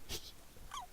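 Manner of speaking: high wheezing giggle; real helpless laughter. Real, not acted. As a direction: laugh until the breath goes. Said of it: "why I'm laughing".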